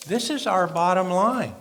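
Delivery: ordinary delivery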